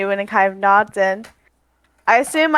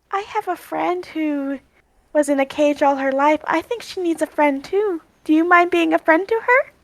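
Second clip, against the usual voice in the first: raised, coaxing voice; gentle Aria; 5 semitones higher than usual. Acted. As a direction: coax a shy animal kindly. Aria coaxes the monkey.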